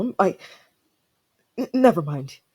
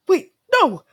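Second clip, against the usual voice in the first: startled outburst; 10 dB louder than usual. Acted. Startled by a nickname.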